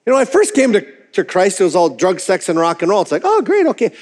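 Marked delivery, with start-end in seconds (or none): 3.2-3.9 much higher bright exclamation; mock cheerful. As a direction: play the eager new convert. New believer's cheery reaction.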